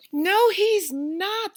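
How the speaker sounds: high, loud, slow scream. A narrator's reading. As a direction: Eddie screams at Mom that the bear is real, high and loud. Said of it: "screamed Eddie".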